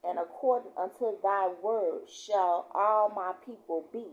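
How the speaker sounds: loud, slow scripture proclamation; scripture reading. Real, not acted. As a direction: proclaim the verse to the congregation. Reads scripture aloud.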